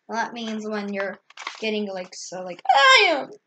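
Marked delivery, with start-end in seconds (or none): 2.7-3.3 high, loud furious cry; shriek. Acted. Pig seeing red at the photographer, a high loud shriek. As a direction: scream it in a jealous fit. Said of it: "he shrieked".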